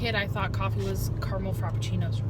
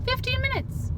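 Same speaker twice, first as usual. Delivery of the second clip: high amazed exclaim; surprised. Real, not acted. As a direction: shoot up in disbelief. Amazed at short drive.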